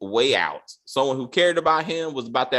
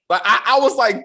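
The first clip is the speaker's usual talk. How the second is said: ordinary talk, nothing marked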